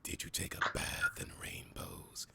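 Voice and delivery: low, slow, breathy seductive whisper; sultry voice. Acted. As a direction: purr it low and slow in their ear. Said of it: "say in a sultry voice".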